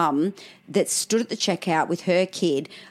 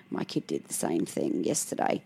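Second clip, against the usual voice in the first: hushed reassurance; 13 semitones lower, 10 dB quieter than usual. Retold or imitated. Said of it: "I was like".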